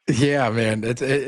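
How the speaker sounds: ordinary talk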